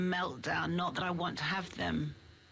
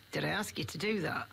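put-on Yorkshire accent. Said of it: "clearly comes in a Yorkshire accent".

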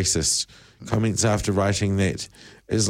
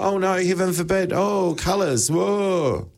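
high, hammed-up horror; mock panic; 9 semitones higher than usual. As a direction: flail and squeal in mock alarm. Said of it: "I'm frantically waving my arms around".

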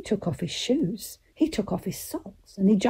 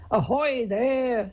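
slow, higher drawn-out call; answering hail; 4 semitones higher, slower than usual. Acted. Girl answering her uncle's pirate game, calling back. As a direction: stretch it out like a sailor's hail.

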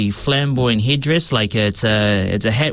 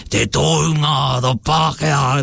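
grandfather's emphasis; 3 semitones higher than usual. Retold or imitated. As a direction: lean on each word and hold it, like his grandfather. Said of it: "how I hold, emphasize my words".

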